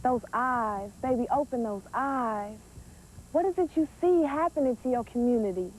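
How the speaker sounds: ordinary talk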